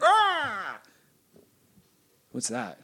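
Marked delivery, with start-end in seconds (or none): 0.0-0.8 high, drawn-out creature cry; little monster cry. Pretend. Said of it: "And it was like".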